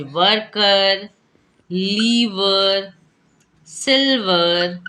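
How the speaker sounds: each word drawn out slowly; slow demo. Shown as a demonstration. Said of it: "तो क्या पढ़ते हैं इसको? अर पढ़ते हैं".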